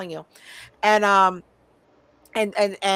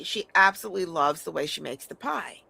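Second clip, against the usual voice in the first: ordinary talk, nothing marked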